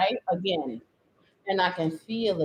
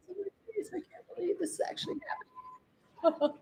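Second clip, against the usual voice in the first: softer and higher, disbelieving; real stunned disbelief; stunned quiet; 9 semitones higher, 14 dB quieter, faster than usual. Real, not acted. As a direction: murmur in disbelief. Paint on her face.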